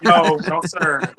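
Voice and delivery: normal talking voice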